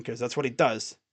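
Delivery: ordinary talk, nothing marked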